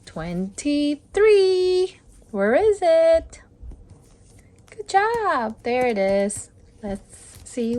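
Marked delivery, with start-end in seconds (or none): 0.1-2.0 drawn-out, loud day count; slow announcement. Shown as a demonstration. Host announcing the day number, slow and loud. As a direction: stretch the number out. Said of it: "slow announce".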